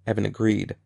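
plain reading voice

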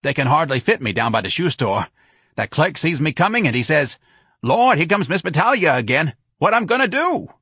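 big exasperated New Orleans woman's voice; brash Santa character. Acted. Santa mimics the shoe clerk, exasperated.

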